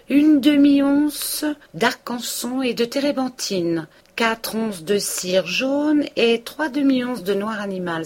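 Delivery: drawn-out character voice; Binet ordering. A narrator's reading.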